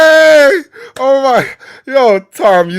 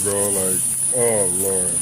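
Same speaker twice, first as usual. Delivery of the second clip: normal talking voice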